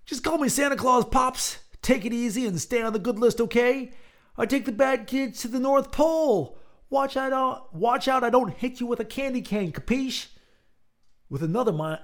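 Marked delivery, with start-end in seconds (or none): 0.1-10.3 high, louder gangster Santa; menacing fake Santa. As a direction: taunt him like a thug in a Santa suit. Fake Santa voicing his captive.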